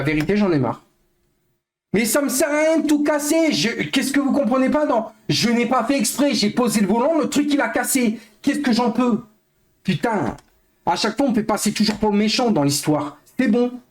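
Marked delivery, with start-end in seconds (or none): 1.9-13.8 high, fast exasperated venting; exasperated rant. Real, not acted. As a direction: defend yourself, fed up and heated. Streamer defending himself, exasperated.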